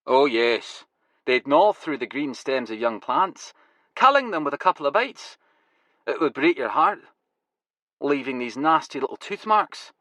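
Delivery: put-on Glasgow accent, rueful. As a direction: Glaswegian grower mourning his plants. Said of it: "his Glasgow accent now going full throttle".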